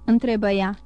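ordinary delivery

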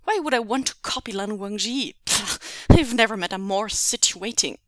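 loud defensive snapping; angry captor defending himself; snapped. Acted. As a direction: spit out the insults. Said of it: "Shu snapped".

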